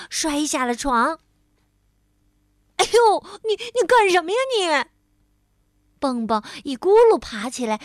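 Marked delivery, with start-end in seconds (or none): 2.8-4.9 high, loud indignant yelp; furious. A narrator's reading. Bengbeng just fell off the bed, furious. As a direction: demand an answer, outraged. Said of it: "怒气冲冲地问".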